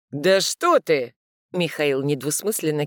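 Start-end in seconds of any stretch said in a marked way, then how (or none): 0.1-1.1 sarcastic. Acted. Mikhail retorts sarcastically.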